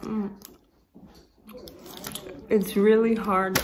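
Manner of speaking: talking with mouth full; chewing. Real, not acted. Seen 0: bites piece at -4, chews with puffed cheeks throughout.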